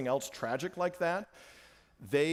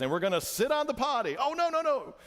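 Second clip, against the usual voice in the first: higher, mock dismay as Gru; 10 semitones higher than usual. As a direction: squeal out the protest. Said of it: "And he goes".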